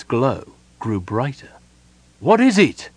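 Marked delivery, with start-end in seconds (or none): 2.2-2.9 high, loud shouted question; shout over thunder. A narrator's reading. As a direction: shout above the storm. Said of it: "Sylvan shouted above the drumming of the thunder".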